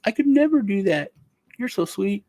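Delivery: put-on high, sweet girly voice; mock girly voice. Mocking girls answering a meme question, high and sweet. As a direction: coo it like a sweet girl.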